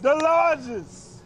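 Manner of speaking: slow, drawn-out emphasis; stretched out. Acted. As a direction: stretch every syllable for fun. Slow drawn-out call-and-response.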